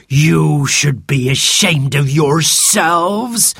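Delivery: slow, indignant telling-off in a character voice; scolding. A narrator's reading. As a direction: scold naughty children sternly. Martha marches in and scolds children.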